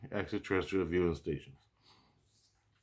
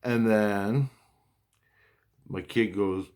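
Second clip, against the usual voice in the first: usual speaking voice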